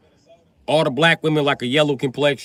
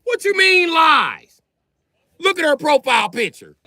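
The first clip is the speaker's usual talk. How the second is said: indignant shout; 5 dB louder than usual. Real, not acted. Answering the crowd's heckle with a shout. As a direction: yell it in disbelief.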